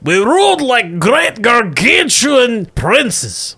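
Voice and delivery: high, slow, grand shout; booming monster voice. Acted. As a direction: proclaim it like a vast ancient beast. Leviathan boasts of its past.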